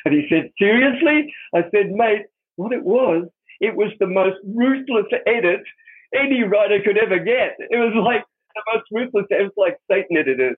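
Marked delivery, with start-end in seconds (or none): 0.6-1.3 much higher, incredulous question; guard can't believe it, astonished. Retold or imitated. 1.9-10.6 convincing the guard, excited and emphatic. Retold or imitated.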